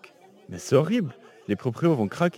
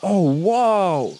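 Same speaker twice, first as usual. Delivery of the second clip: amazed; 4 dB louder than usual. Acted. Amazed at seeing the château.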